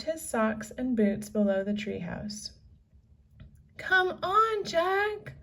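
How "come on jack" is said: high, raised call to her brother; shouted. A narrator's reading. Annie calls Jack from the rope ladder, high shout. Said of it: "Annie shouted".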